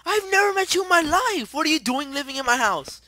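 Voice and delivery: very high, loud screech; high-pitched indignant yelling; outraged screaming. Real, not acted. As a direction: scream in total disbelief at a stranger. Yelling at intruder in game house.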